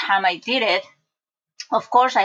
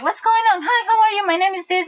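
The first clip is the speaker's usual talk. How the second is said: much higher, sing-song helpful clerk voice; bright bank clerk; 12 semitones higher than usual. Retold or imitated. Bank clerk cheerfully greeting an upset customer, high chirpy voice. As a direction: pitch it way up like a chirpy customer-service lady.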